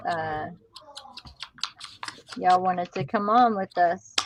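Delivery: ordinary delivery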